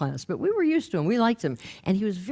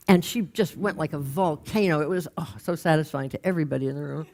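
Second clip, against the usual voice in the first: ordinary delivery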